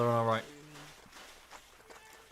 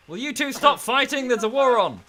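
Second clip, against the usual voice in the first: high, loud telling-off; mock scolding shout; 9 semitones higher, 7 dB louder than usual. Player breaks up two squabbling soldiers, loud high scolding. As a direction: call out over the bickering.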